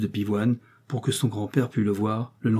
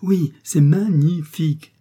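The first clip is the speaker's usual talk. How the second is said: magnifique chopped into slow, separate syllables, high and loud; slow, emphatic scanning of "magnifique" for force; syllable-by-syllable emphasis; 6 semitones higher, 5 dB louder, slower than usual. A narrator's reading. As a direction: hammer out each syllable of "magnifique". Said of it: "en scandant son adjectif pour lui donner plus de force".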